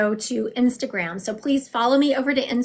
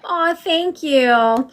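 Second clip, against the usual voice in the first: touched thanks; 6 semitones higher, 7 dB louder, much slower than usual. Real, not acted. Thanked by a viewer on stream.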